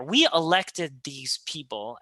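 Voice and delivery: ordinary delivery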